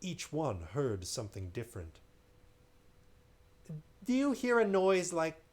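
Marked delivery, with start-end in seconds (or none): 4.0-5.4 frightened question. Acted. Frightened crew member asks.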